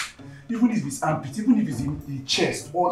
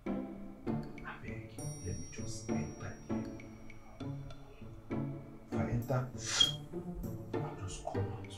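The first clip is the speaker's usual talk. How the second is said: quiet pleading; 12 dB quieter than usual. Acted. Coaxing a partner after the row, quiet pleading. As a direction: plead under your voice, haltingly.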